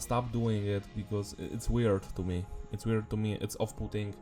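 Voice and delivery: ordinary delivery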